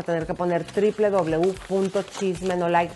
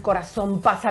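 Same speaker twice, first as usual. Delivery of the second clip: normal talking voice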